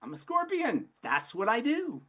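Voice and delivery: a scorpion shrugging off his own nature; smug scorpion. Acted. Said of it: "And the scorpion goes".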